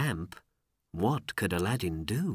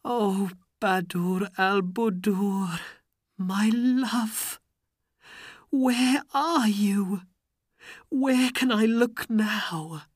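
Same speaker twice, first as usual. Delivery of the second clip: high, plaintive wail; grieving, high-pitched voice; crying lament; 11 semitones higher, slower than usual. A narrator's reading. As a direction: call hopelessly for a lost love. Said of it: "he sat by a river and cried".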